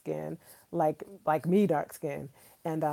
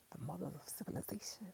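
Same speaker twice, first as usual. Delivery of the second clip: hushed whisper, a bit faster; 19 dB quieter, faster than usual. Real, not acted. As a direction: breathe it like a secret.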